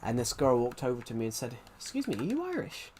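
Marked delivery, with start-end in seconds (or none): 1.8-2.9 high, soft Irish lilt; softly mimicked high Irish accent. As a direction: imitate an Irish girl, pitched well up. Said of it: "she was actually Irish herself".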